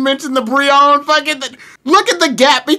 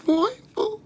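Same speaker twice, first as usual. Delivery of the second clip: much higher, quiet squeak; high soft echo; 7 semitones higher, 11 dB quieter than usual. Retold or imitated. Friend quietly echoes the squeaky disbelieving name. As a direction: echo the squeak under your breath.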